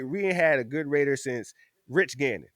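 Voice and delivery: usual speaking voice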